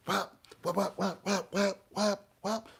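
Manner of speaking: quick high whap sounds like a staff swinging; staff strike noises. Pretend. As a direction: smack the air with your voice.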